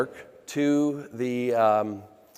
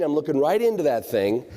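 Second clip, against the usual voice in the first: normal talking voice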